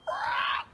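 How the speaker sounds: breathy unvoiced owl cry; owl begging screech. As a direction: rasp out a hungry owl call. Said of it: "which is him saying".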